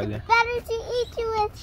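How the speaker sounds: loud wolf shout. Acted. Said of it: "shouted the wolf".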